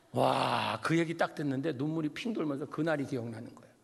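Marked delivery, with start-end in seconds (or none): none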